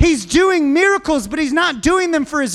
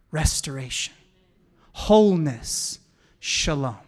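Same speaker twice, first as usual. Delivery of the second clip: hushed reverence; 10 semitones lower, 5 dB quieter than usual. Acted. Preacher drops to hushed reverent words.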